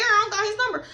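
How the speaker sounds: ordinary talk, nothing marked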